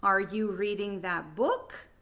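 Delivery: "book" jumps up sharply at the end; rising end intonation. Shown as a demonstration. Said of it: "intonation will go up at the end".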